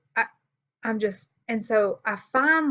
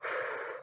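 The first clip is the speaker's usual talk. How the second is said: short blown-out breath, acted; reenacted puff of breath; trying to catch breath; 11 dB quieter than usual. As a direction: imitate breathing hard to calm down. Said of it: "I'm trying to catch my breath".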